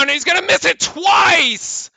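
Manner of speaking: high, loud, stretched-out call; excited shout. Real, not acted. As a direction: yell it as the shot rims out.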